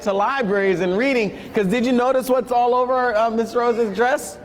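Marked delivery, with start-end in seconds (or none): none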